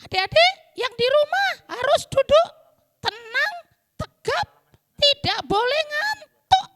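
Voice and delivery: put-on high, loud little-girl orders; bossy puppet girl. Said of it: "Pinter Pinky".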